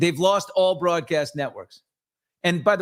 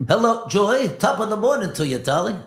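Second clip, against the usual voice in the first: higher-pitched, hammed-up TV host with bad English accent; Regis; 6 semitones higher than usual. Host teasing co-host's English accent, hammed-up impression. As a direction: greet like an American host faking an English accent. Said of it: "Your English accent is Regis on vacation".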